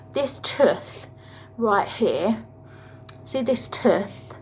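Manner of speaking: mock a patient saying "tooth" the wrong way; mock dentist talk. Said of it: "go to the dentist and go".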